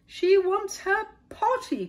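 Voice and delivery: very high, loud and slow shout; cried out. A narrator's reading. General passing the call along, loud high cry. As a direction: bellow it like an order. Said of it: "cried the general".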